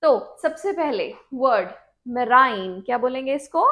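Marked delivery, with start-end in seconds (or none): none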